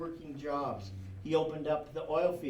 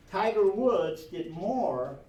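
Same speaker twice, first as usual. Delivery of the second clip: Tiger Woods said slow and louder; emphatic, slow and loud; 4 semitones higher, 6 dB louder, slower than usual. Real, not acted. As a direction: lean hard on every word. Emphatic point.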